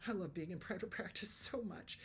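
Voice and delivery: soft whispered aside; hushed, happy whisper. Real, not acted. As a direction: confide it in a whisper.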